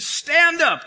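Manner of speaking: loud high call to stand up; God's command. Retold or imitated. God commanding Ezekiel, loud and high. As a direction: bark the command upward. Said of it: "He said".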